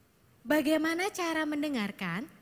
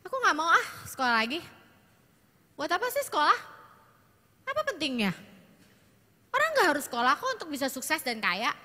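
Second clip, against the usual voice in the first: put-on whiny, complaining teen, a little higher; sulky teenage daughter; 4 semitones higher, 3 dB louder, faster than usual. Shown as a demonstration. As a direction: act out a daughter refusing school. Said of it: "dengan intonasi begitu". (anak remaja merengek malas sekolah)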